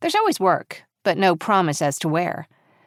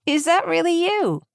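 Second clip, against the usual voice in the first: delighted, pitched up high; bright disbelief at an old friend's voice; a high, happy is that really you; pleasantly surprised; 10 semitones higher than usual. Acted.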